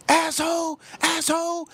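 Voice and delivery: high-pitched mock scream; retold scream. Woman heard screaming from her car, high mock yell. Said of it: "She started screaming".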